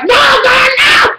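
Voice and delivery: a high wailing plea at the TV screen; anguished cry. Retold or imitated. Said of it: "I was like".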